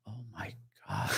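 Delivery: awed murmur. Retold or imitated. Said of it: "I'm just going like".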